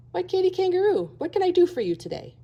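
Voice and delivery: high sugary crocodile greeting. A narrator's reading. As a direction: greet her like a sly, sweet crocodile. Crocodile greets Katy sweetly.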